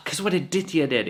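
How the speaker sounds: wife griping to Guruji on the phone, mock plaintive; complaining wife. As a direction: whine it with a put-upon sigh. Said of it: "she'd be like".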